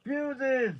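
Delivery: high, loud, frantic screech; desperate scream, climbing in pitch. A narrator's reading. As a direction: screech it out in agony. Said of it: "Stanner screeched".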